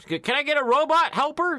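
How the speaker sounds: high pleading cartoon alien; pleading villain. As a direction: beg for a helper in a high squeaky voice. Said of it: "And they're like".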